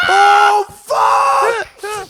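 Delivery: high, loud drawn-out yell; mock outcry. Comic reacting to a roast line, mock high-pitched yell. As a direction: cry out as if badly burned.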